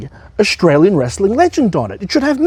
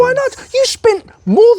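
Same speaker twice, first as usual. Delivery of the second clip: way higher outraged squeal; indignant; slower than usual. Acted. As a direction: shriek the question back. Indignant rival.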